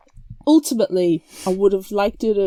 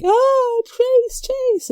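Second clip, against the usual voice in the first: very high mock anguished cries of his name; wailing Chrissy; 5 dB louder, much slower than usual. Chrissy mock-wailing over Chase's body. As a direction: wail the name like a distraught girl. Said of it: "Chrissy's like".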